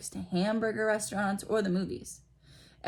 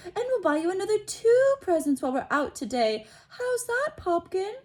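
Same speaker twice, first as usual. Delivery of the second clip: high, louder, sugary pleading; doting mother; 13 semitones higher, 5 dB louder than usual. A narrator's reading. Aunt Petunia placating Dudley, high sugary coaxing. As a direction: hurry to placate the child. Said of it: "she said quickly".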